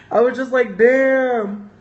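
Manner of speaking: high and loud through a laugh; cracking up in disbelief. Real, not acted. Laughing amazed reaction.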